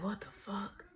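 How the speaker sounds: whispered disbelief; very soft, voiceless shock; retold stunned whisper. Retold stunned reaction while high.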